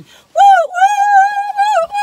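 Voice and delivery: very high, loud woo-hoos; shrieking woos of delight; joyful whoops. Real, not acted. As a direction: let the cookie joy explode. Cookie joy whoops.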